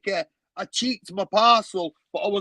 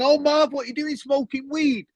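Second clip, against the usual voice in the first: police officer; 8 semitones higher than usual. Retold or imitated. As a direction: hail him like a cheery cop. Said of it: "he's come up to me and he's gone".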